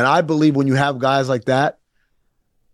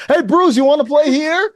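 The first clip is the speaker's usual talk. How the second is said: blunt reporter; 11 semitones higher than usual. Retold or imitated. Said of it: "You're like".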